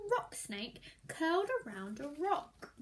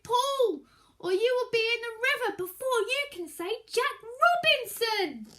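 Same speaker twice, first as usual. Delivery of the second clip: higher, louder snake shout; 6 semitones higher, 5 dB louder than usual. Acted. Python shouting to the child to pull, loud. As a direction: yell the urgent warning. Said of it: "the snake shouted".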